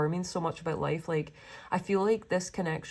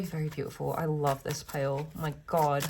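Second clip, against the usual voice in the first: ordinary delivery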